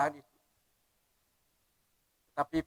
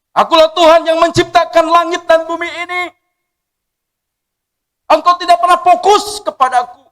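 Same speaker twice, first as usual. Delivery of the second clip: loud, lifted divine declaration; voice of God; 10 semitones higher, 13 dB louder than usual. Acted. God declaring himself to the preacher, booming. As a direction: announce it as the Creator himself. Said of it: "Tapi dia berkata".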